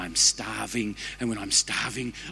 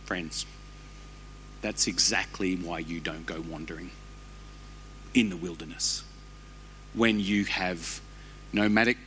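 earnest appeal; 5 semitones lower, 5 dB quieter than usual. Real, not acted. Preacher turns to congregation.

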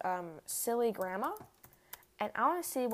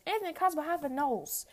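imitating the fan; 7 semitones higher, 5 dB louder than usual. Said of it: "And then he goes".

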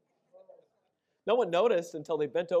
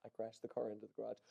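much softer and fast, swallowed words; sheepish mumble; 5 semitones lower, 14 dB quieter, faster than usual. Retold or imitated. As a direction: mumble the confession, hoping not to be heard. Confessing the crash to mom.

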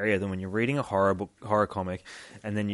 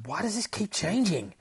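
higher-pitched, bewildered question; bewildered inner monologue; 7 semitones higher than usual. Acted. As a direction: wonder it in rising disbelief. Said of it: "we've got the inner monologue again".

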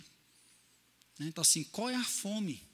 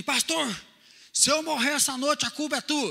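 imitated loud, higher-pitched shout; shouted blame; 6 semitones higher, 7 dB louder than usual. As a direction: call out an accusation from the stairs. Homem grita da escada ao pastor. (grito imitado de acusação)

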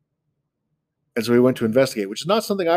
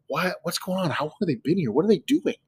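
voicing Nanabush with a higher, puzzled voice; 6 semitones higher, 4 dB quieter than usual. Nanabush finding the sugar-stuffed villagers, baffled and higher. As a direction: lift the voice, baffled by the scene. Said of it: "he's like".

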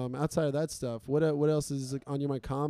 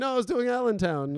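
pitched way up, innocent excuse; defensive high excuse; 8 semitones higher than usual. Retold or imitated. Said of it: "You gotta say, like".

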